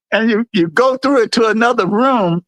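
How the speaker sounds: ordinary talk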